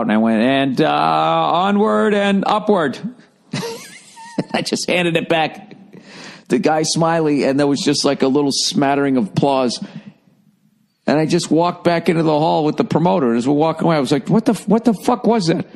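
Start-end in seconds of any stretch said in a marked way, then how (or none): 0.4-3.0 petering out. Retold or imitated. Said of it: "I just sort of petered out". 14.3-15.6 baffled outburst. Retold or imitated. Baffled walking off with promoter.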